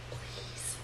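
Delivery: silent mouthed plea, no voice; just shape the word with your lips. A narrator's reading. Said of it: "she mouths".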